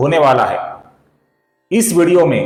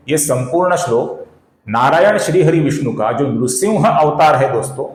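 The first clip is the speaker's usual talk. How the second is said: normal talking voice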